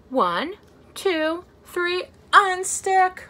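building to a loud slow unstick; big countdown. Acted. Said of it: "let's do a really big unstick".

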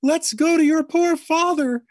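high, loud frantic cry to wake the house; hysterical shout. A narrator's reading. Mother shouting in alarm about father, high frantic shout. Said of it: "It was this shout".

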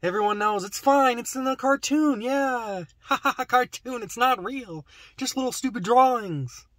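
high, mocking dismissal of cartoons; mock dismissive voice. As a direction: sneer it like a know-it-all. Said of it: "If I put it in a cartoon".

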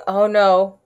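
ordinary delivery

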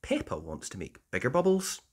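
ordinary delivery, nothing marked

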